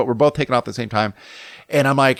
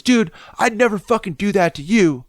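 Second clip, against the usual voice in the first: indignant quote; 9 semitones higher than usual. Retold or imitated. Quoting himself, indignant.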